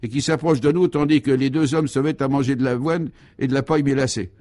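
high, astonished running commentary; highly excited. Acted. Guy shows his friends the horse leaving its seat, excitedly. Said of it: "fortement excité".

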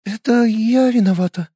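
ordinary delivery, nothing marked

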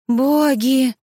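narrated yelp of shock; startled squeal. A narrator's reading. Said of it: "взвизгнула я".

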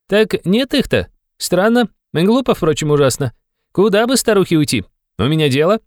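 high-voiced young man, puzzled. A narrator's reading. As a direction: wonder aloud in a youthful high pitch. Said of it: "должно быть очень молодой". (высокий молодой голос, озадаченно)

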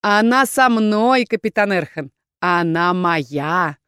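slow, drawn-out gloating claim; possessive villain. Acted. Rebel leader claiming the girl before Erkhan, gloating. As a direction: stretch the last words possessively.

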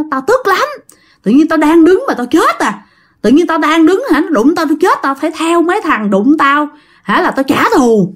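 high, agitated vengeful spirit voice; high-pitched ghost ranting about his death; angry ghost. Acted. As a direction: rant like a wronged dead man. Ghost's parting words.

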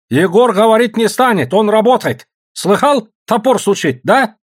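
high, loud, insistent refusal; harsh rebuff. Acted. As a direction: shut down their pleading. Boss rebuffs pleading women.

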